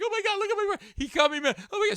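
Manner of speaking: high-pitched mock hysteria; shrieky, loud, high freak-out; screaming girlfriend. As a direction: imitate a panicked girlfriend screaming. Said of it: "Screaming, and the guy".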